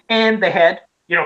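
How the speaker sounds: ordinary delivery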